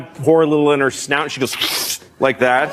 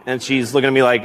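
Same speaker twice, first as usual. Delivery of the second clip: ordinary delivery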